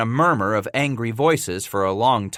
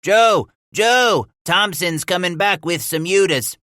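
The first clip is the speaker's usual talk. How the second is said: high loud call from the wall; shouted; 10 semitones higher, 5 dB louder than usual. A narrator's reading. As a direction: yell down from the top of the wall. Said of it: "He shouted down to Walker".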